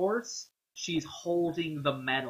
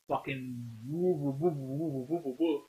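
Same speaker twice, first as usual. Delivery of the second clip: soft repeated woo sounds imitating frantic swings; lightly voiced swooshes; mock whoosh noises; 4 dB quieter than usual. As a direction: hum out the whooshing lightsaber chaos. Mocking frantic action scenes.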